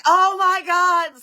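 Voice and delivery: high, loud, drawn-out cry of alarm; loud retold freak-out; panicked outcry. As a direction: cry out in shock, stretching the words. Retold panic at eye splash.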